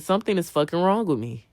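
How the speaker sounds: ordinary talk, nothing marked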